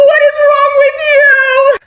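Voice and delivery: very high, slow, wailing cry; slow high-pitched tearful shriek; retold crying scream. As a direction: stretch it out like a sobbing meltdown. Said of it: "I'm literally going crazy".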